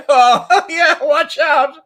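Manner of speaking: loud, high, slow laughing watch out; hammed-up alarm, laughing; laughing warning. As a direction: cry out a warning through laughter.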